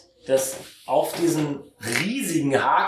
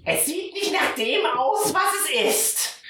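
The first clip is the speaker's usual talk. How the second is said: Tantchen, hohe Stimme. Acted. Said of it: "dreht sie sich zu dir um und sagt". (Tantchen mit sehr hoher, langsamer Piepsstimme)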